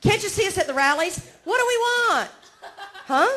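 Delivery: normal talking voice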